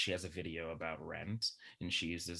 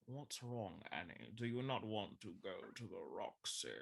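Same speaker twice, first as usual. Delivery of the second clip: put-on villainous professor voice; Alan Rickman as Snape; 3 semitones higher, 4 dB quieter than usual. Daddy Warbucks asking Annie out, in a Snape impression. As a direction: impersonate a drawling British screen villain. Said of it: "did the voice of, um, Alan Rickman as Snape".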